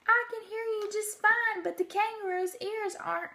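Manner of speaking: an anxious elephant asking the mayor; Horton calling back. A narrator's reading. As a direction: Horton checking on the Whos, high and urgent. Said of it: "And Horton called back".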